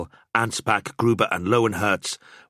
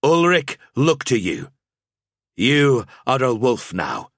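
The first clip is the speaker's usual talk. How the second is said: higher, louder solemn rite; sober priestly pronouncement; 5 semitones higher, 5 dB louder than usual. Acted. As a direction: intone it like a temple priest. Rite's closing blessing.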